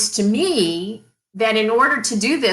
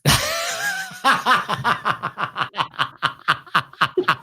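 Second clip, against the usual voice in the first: long helpless laugh. Real, not acted. Laughing at her own prank story. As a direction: laugh it out, can't stop.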